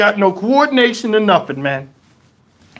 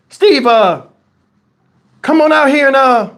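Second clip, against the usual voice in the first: mildly raised calling voice; 5 semitones higher, 4 dB louder than usual. Retold or imitated. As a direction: call a friend out to play. Others calling him outside to play.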